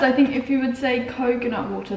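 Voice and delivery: usual speaking voice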